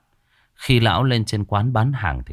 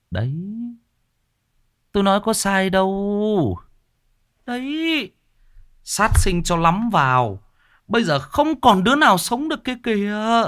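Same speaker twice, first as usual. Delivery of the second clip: gossiping villager; 13 semitones higher than usual. Acted. Villagers gossiping about the boy's death, high gossiping voice. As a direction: say I-told-you-so in a high, stretched voice. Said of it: "Mọi người đang bàn tán xì xào".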